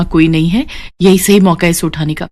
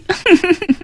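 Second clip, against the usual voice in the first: gloating laugh; 10 semitones higher, 4 dB quieter than usual. Acted. Schemer gloats over winning move.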